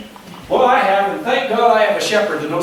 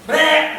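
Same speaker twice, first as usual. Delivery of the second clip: mock sheep bleat, pitched up; 7 semitones higher than usual. Sheep stuck on its back.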